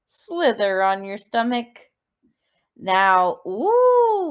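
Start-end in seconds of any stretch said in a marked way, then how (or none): 3.5-4.3 surprised ooh. Shown as a demonstration. Spotting something new on the floor.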